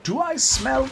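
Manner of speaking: high, half-formed Scrooge attempt. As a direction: start the old miser line, then break off. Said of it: "Scrooge in the distance, like".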